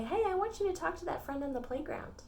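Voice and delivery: lightly voiced call, high and soft; a hint of a gentle, coaxing request; God. God asking a child to befriend someone, lightly voiced coaxing. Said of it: "Maybe God's gonna say".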